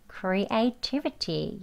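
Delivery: slow soft "creativity" drill; syllable by syllable. Shown as a demonstration. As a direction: say "creativity" one syllable at a time, gently. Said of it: "천천히 음절 하나하나씩".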